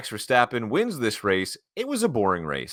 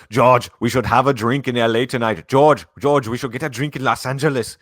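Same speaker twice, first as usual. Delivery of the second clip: loud, raised mock-Austrian team boss; excited radio call with a touch of Schwarzenegger; Toto; 5 semitones higher, 7 dB louder than usual. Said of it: "Your Toto's really funny".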